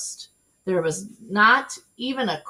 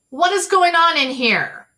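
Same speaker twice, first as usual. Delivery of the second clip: higher, loud bossy demand; stern demand; 11 semitones higher, 9 dB louder than usual. Acted. Eugenia bursting into the room, demanding answers. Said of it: "asked Eugenia".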